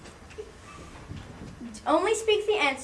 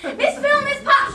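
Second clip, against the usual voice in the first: higher, louder impatient call; impatient yell; 8 semitones higher, 4 dB louder, slower than usual. Acted. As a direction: bellow for the assistants, fed up now. Boss calls them again, impatient.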